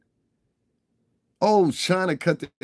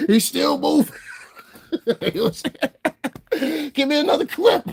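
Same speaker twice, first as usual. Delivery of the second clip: high-pitched, laughing through the words; cracking up. Real, not acted. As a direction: barely get it out through real laughter. Cracking up while telling story.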